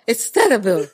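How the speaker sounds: ordinary talk, nothing marked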